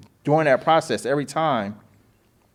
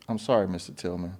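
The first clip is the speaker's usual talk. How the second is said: lower, softer mock apology; sheepish apology; 6 semitones lower, 8 dB quieter than usual. The agent apologising to him over the missing signature, meek and soft. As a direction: play her backing down meekly.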